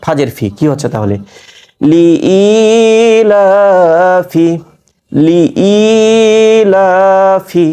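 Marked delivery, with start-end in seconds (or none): 1.9-4.5 লি ই লাফি with long ই and লা, short ফি; measured elongations. Shown as a demonstration. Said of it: "লা এক আলিফ পরিমাণ টান". 5.1-7.7 লি ই লাফি with long ই and লা, short ফি; measured elongations. Shown as a demonstration. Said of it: "লা এক আলিফ পরিমাণ টান".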